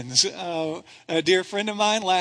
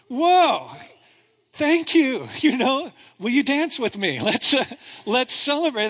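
higher and louder, jubilant; thrilled whoa and thanks; overjoyed; 3 semitones higher, 3 dB louder than usual. Real, not acted. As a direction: celebrate like the news just came. Recalls hearing cancer-free news.

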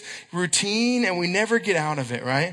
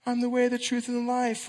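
mock pious voice; 6 semitones higher than usual. Pastor mocking the van's churchy outside, lightly pious voice. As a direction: hold the words up as a show of piety. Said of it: "the outside looks".

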